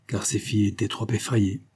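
ordinary delivery, nothing marked

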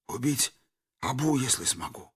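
barely voiced dying whisper; faint deathbed whisper. A narrator's reading. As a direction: breathe it out with the last strength. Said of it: "прошептал умирающий ресторатор".

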